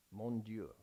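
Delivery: a soft French-accented Mon Dieu. Shown as a demonstration. Preacher tries French for the congregation, soft French accent. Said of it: "I got the accent".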